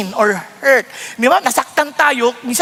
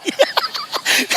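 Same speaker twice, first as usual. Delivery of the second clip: breathy laugh; 4 dB quieter than usual. Real, not acted. Laughs at own joke.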